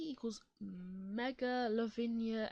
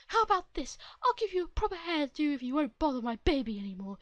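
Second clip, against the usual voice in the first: louder, higher pushy mother voice; pushy mom; 3 semitones higher, 10 dB louder than usual. Acted. Entitled mother pushing a haircut on the boy. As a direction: push the offer like a bossy mother.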